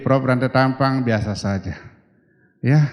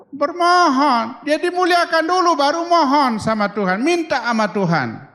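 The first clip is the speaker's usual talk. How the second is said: emphatic preaching; 11 semitones higher, 3 dB louder than usual. Real, not acted. Preacher stressing point.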